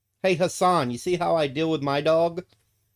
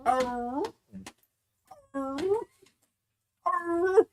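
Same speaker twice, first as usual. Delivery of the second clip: high drawn-out baying, arr stretched long; right hound bawl; much slower than usual. Shown as a demonstration. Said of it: "It's an".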